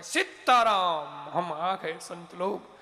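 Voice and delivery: loud, long-drawn holy greeting; calling out. Retold or imitated. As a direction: call "Sitaram" out at the door, stretched. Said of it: "आवाज लगाई".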